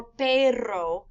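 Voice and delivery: perro drawn out slowly with a Spanish double R; double R demo. Shown as a demonstration. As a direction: stretch perro to show the double R. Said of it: "a single R sounds different than a double R".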